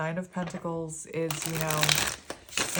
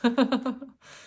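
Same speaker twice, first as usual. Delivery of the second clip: quick loud burst of laughter; real little laugh; short laugh; 7 semitones higher, 7 dB louder than usual. Real, not acted. Laughs after remembering a hard pile.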